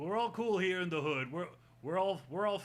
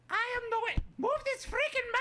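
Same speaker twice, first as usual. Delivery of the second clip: high puppet voice; faster than usual. Acted. Puppet voice loud.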